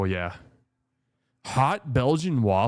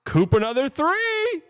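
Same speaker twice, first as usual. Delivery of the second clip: high, thrilled shout of joy; excited cheer; 14 semitones higher, 3 dB louder than usual. Real, not acted. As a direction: cheer the shot like a fan. Cheering a made three.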